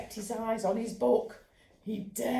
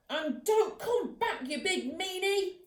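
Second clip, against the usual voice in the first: defiant yell; 9 semitones higher than usual. Acted. Ernie shouting after robber.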